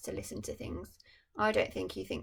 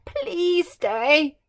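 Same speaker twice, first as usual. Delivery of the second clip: higher, louder pleading; begging Emperor; 8 semitones higher, 14 dB louder than usual. Acted. The Emperor begging the little bird to stay, pleading. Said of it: "he begged the little bird".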